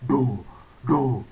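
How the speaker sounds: "Do" kept down and deep; deep low do. Shown as a demonstration. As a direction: sink "Do" low. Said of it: "Do, that one is deep".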